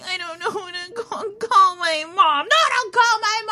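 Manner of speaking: high, whiny fake sob; mock crying. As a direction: wail it like a drunk pity party. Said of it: "and then I'm like".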